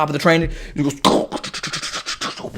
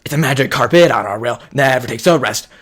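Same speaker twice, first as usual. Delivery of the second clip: mock conductor announcement; 5 semitones higher, 8 dB louder than usual. Conductor's announcement in retold film.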